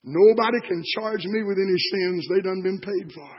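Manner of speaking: ordinary delivery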